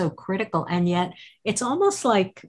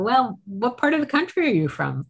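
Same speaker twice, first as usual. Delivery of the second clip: curious question; 7 semitones higher than usual. Retold or imitated. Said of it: "because I go".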